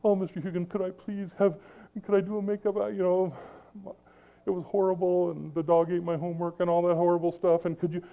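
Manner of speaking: high, pleading student begging for a makeup test; whiny student pleading. Retold or imitated. Student pleading with the teacher for a break. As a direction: beg like a kid who missed three tests. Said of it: "a student comes to him ... and say".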